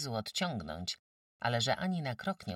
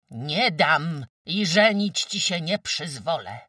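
loud, high, drawn-out refusal; angry outburst; 8 semitones higher, 12 dB louder than usual. A narrator's reading. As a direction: burst out refusing, stretching the words. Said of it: "buchnęła". (wybuch gniewu, głośno i przeciągle)

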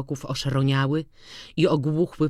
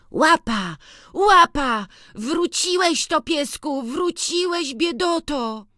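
joyful exclaiming; 13 semitones higher, slower than usual. A narrator's reading. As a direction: call the lost dog, overjoyed. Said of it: "wykrzykiwał". (chłopiec radośnie woła psa, wykrzykując wysoko)

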